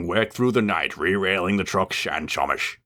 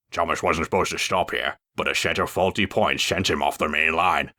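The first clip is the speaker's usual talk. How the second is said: ordinary delivery, nothing marked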